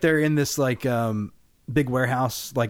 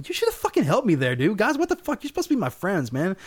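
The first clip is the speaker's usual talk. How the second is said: higher pitched, aggrieved whine; mock whiny complaint; 6 semitones higher than usual. Crew member whining at the killer about not helping, mock aggrieved. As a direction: act hurt and betrayed, half-joking.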